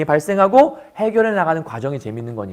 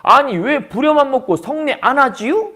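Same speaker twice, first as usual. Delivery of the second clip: sulky retort; 7 semitones higher than usual. Acted. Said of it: "그거에 대한 대꾸예요".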